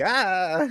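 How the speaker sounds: short mock scream; startled scream. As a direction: jump back from the axe with a shriek. Buffy startled by swinging axe.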